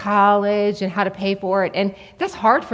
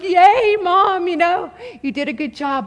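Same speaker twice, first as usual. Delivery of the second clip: higher, louder playful yay; mock self-cheer; 7 semitones higher, 4 dB louder than usual. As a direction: cheer yourself on, hammed-up. Mom cheering herself, mock.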